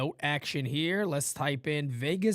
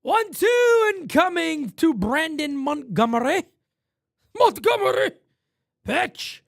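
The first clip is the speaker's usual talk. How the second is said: raised broadcaster call of the at-bat; play-by-play; 13 semitones higher, 7 dB louder than usual. Acted. Streamer calling his baseball game, raised announcer voice.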